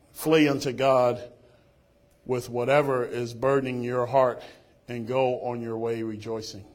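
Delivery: preacher urging the flock to flee unto God; preaching at full force. Real, not acted. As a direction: hammer the quote home. Preaching Spurgeon quote with force.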